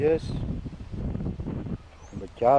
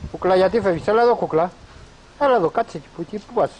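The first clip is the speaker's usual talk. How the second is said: high, loud coaxing call after a wandering pet; 6 semitones higher, 6 dB louder, faster than usual. Real, not acted. Owner calling the runaway animal back to her, high and loud.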